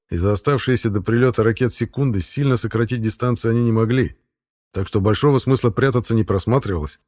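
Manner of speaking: ordinary delivery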